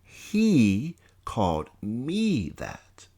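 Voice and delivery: very slow, falling realisation on "He called me that."; stunned statement. Acted. Narrator accepting the insult, falling realisation. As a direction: let "He called me that." sink down flatly, slowly.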